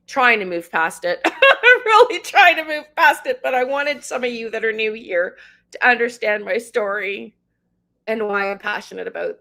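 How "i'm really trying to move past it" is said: laughing. Real, not acted. Laughs at own rant.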